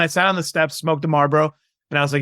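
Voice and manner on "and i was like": ordinary talk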